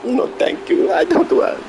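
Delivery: high-pitched whiny no; high squeaky refusal. Real, not acted. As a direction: squeak it out, fed up. Refusing packs, voice jumps high.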